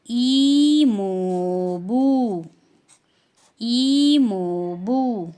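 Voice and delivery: "ईमोबू" very slow and loud; teacher modelling Korean "ईमोबू"; Korean term. Shown as a demonstration. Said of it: "तो उनको बोलेंगे".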